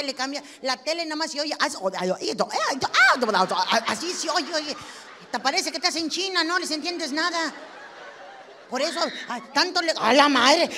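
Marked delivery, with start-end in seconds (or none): 1.6-4.5 imitated channel noise. Wife mimicking the TV as husband flips channels, choppy noises. Imitating TV sound while flipping. 9.5-10.7 loud laughing outburst; laughing exclamation. Real, not acted. Comic complaining about husband's remote, loud laughing outburst. Exasperated outburst at channel flipping.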